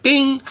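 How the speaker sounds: a high little bing sound effect; light bulb bing. Retold or imitated. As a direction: make the ding of an idea clicking on.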